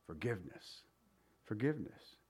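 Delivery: dropped almost to a whisper, low and soft; a quiet, reverent forgiveness; hushed answer. Acted. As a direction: let the word fall soft and low. Answer to rhetorical question.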